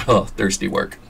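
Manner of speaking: ordinary delivery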